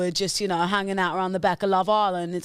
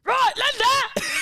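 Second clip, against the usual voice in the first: Alfie impression; slower than usual. Copying the Alfie voice for a friend, slow and shouted. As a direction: bellow it slowly like Alfie. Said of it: "do Alfie for me again".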